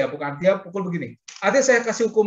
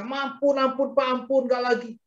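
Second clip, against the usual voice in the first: high-pitched imitation of a child begging; mock a punished child's plea, pitched up; tearful pleading; 6 semitones higher than usual. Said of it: "dia nangis".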